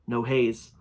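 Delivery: ordinary delivery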